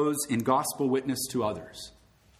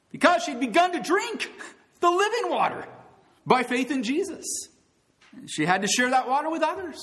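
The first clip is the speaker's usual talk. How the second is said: high, ringing pulpit voice; excited preaching; 13 semitones higher than usual. Acted. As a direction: lift it up with joy.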